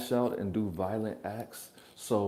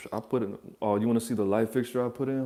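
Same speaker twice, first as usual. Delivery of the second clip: usual speaking voice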